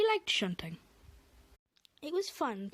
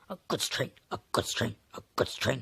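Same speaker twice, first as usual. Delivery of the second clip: low grumbling engine, much lower; 10 semitones lower than usual. Acted. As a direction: mutter it deep and sulky. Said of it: "he grumbled".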